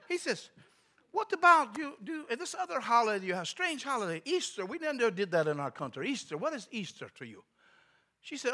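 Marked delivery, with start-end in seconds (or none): 1.1-7.4 put-on high, puzzled Russian-accented questioning; broken English. Visitor asking clerk about Easter, broken English accent. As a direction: imitate a foreigner quizzing in broken English. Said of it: "he spoke real broken English".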